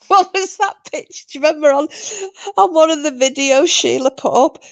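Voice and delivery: high, giggly recall; laughing. Real, not acted. Recalling Sheila's video picture to friends, laughing. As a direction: struggle to get it out through laughter.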